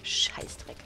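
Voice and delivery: whispered curse to himself; cursing under breath. Real, not acted. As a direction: hiss the swear word quietly. Muttered curse after dying.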